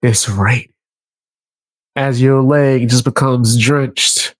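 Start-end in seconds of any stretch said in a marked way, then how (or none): 0.0-0.7 lightly voiced low, casual guy; imagined stranger. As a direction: say it lower, deadpan to his face. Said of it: "he look at you".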